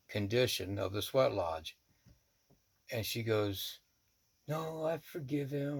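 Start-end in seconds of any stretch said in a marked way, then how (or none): 4.5-5.8 voicing her higher-pitched, mild protest; retold protest. Woman answering the challenge that she is still angry, retold protest. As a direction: mildly insist in a higher imitated voice. Quoting woman's reply to him.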